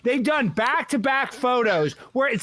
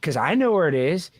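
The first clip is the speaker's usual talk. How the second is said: much lower, deadpan conspiracy reveal; 8 semitones lower than usual. Pretend. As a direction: drop low for the punchline.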